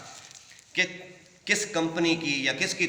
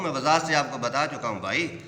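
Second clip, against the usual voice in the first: usual speaking voice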